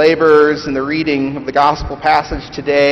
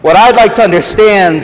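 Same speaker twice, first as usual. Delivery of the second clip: loud, higher, aggrieved working man; bitter ghost; 7 semitones higher, 8 dB louder than usual. Acted. Big Ghost accusing the bright Spirit, loud and indignant. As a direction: bluster like a wronged, bitter ghost.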